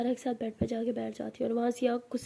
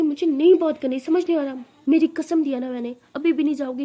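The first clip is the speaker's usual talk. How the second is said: angry shouting; 3 semitones higher, 9 dB louder than usual. Acted. Sister's room fight, ordering out.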